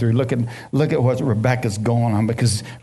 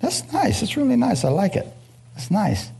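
put-on admiring kid; 8 semitones higher, 3 dB quieter than usual. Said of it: "I said".

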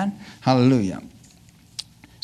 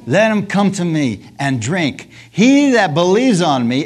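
slow, raised preaching cry; 4 semitones higher, 5 dB louder than usual. Retold or imitated. Preacher voices Jesus calling out the thirsty, crying out. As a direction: build the call higher and louder. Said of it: "he cries out".

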